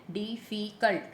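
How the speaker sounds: डिफिकल्ट in three separate syllables, short gaps between. Shown as a demonstration. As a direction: say डि, फि, कल्ट distinctly. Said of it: "तीन syllable हैं". (डिफिकल्ट के तीनों syllable अलग-अलग)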